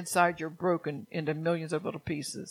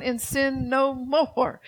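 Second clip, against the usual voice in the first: and sin no more stretched slowly and pitched higher; slow emphatic exhortation; 5 semitones higher, much slower than usual. Real, not acted. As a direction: press each word of and sin no more. Preacher exhorting the congregation.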